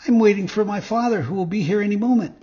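high, quick little marionette voice; puppet. A narrator's reading. Puppet answers the fox, high quick puppet voice.

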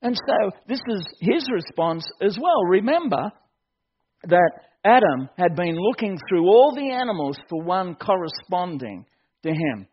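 normal talking voice

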